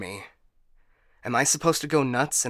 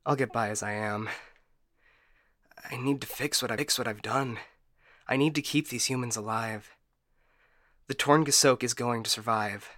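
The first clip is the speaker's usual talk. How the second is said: quietly gather yourself after an outburst; subdued resolve; 2 semitones lower, 5 dB quieter than usual. Acted. AI vowing to fix its mistake, subdued.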